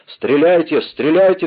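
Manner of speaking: high, loud, urgent order; shouted. A narrator's reading. Mordaunt urges the group to fire, shouted. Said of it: "кричал Мордаунд".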